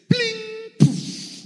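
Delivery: high ringing pling, then loud airy poof; imitated magic chime and puff; magic sound effects. Preacher imagining a well popping out of nowhere, imitated sounds.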